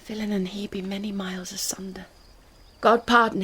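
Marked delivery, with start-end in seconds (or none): 0.0-2.1 hushed secret aside; whispered aside. Acted. Juliet whispering aside so mother can't hear. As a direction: whisper it to yourself.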